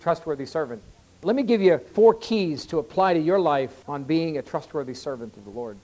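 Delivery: ordinary talk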